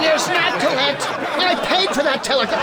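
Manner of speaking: high, indignant protest. Acted. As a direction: squawk it out in outrage.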